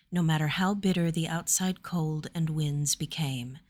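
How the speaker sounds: usual speaking voice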